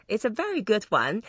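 normal talking voice

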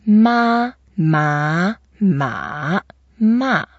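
drawn-out "ma"s: level, rising, dipping, then a sharp fall; four tones, slowly. Shown as a demonstration. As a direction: demonstrate each "ma" slowly, flat, up, down-up, down. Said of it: "only slowly".

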